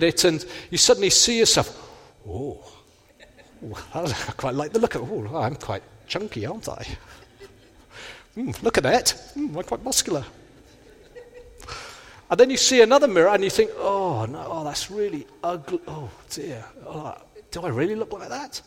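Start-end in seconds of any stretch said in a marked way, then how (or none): 2.3-10.3 softer, smug murmur at his mirror image; preening over a flattering reflection, softer; mock self-admiring. Preacher mimics vain mirror-gazer, smug and soft. 13.8-18.6 soft, sinking groan of disappointment; recoiling from an ugly reflection, much softer; mock dismay. Preacher voices someone horrified by their reflection, soft dismay.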